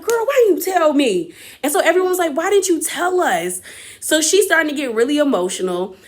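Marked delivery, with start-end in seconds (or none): 0.0-1.3 high, incredulous friend's reaction; playfully imitated shocked complaint; mock indignant surprise. Voices friend reacting to surprise news. 2.4-3.6 high, incredulous group reaction; imitating astonished friends; retold shocked question. Voices table reacting to surprise news.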